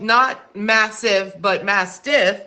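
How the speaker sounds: slow, deliberate contrast of "massive" and "Mastiff"; careful contrast. Shown as a demonstration. Quiz host clarifying the breed name, slow contrast on "Mastiff". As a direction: spell out "Mastiff" slowly against "massive". Distinguishes two similar-sounding words.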